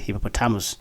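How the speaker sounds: hippopotamus as hippo-po-TAM-us; mispronunciation. Shown as a demonstration. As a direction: say hippopotamus wrongly, stressing TAM. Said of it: "have been pronouncing it".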